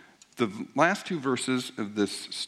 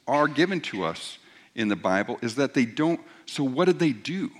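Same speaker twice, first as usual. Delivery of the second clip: ordinary delivery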